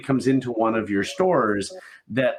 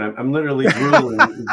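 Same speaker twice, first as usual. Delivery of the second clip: laughing; 5 semitones higher, 6 dB louder than usual. Real, not acted. Host laughs at mouth-watering description.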